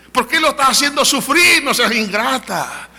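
regaño agudo y fuerte, imitando a parientes; regaño indignado. Retold or imitated. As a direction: reprende como un familiar escandalizado. Relatives scold her for breaking up. (reproche teatral, muy agudo, alargando el final)

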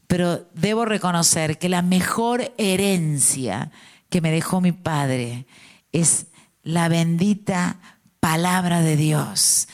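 ordinary delivery

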